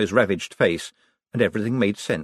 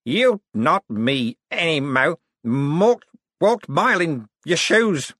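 slow, slurred delirious rambling, pitched up; 7 semitones higher, 3 dB louder, slower than usual. A narrator's reading. Delirious man rambling at his rival, slurred. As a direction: slur it like someone barely conscious. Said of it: "Cosmo slurred".

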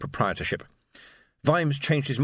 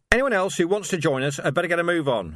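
raised and higher, calling across the street; shout; 7 semitones higher than usual. A narrator's reading. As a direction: call out to the far barricade. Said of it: "he shouted".

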